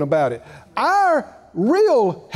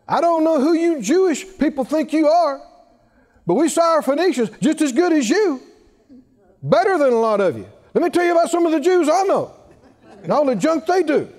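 hammed-up offended rant, quick and higher-pitched; indignant, offended; 6 semitones higher, faster than usual. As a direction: play someone mad and telling people off. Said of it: "become indignant, mad, angry, offended".

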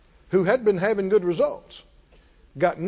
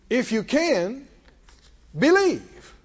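"If you can" lifted in disbelief, then believe rings out higher; stress on if you can; 4 semitones higher, slower than usual. Shown as a demonstration. Preacher echoes the father with a rising "If you can". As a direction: throw "If you can" back as a question, then call out believe. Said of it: "there's an emphasis on the word "if you can".

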